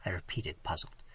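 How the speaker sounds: ordinary delivery, nothing marked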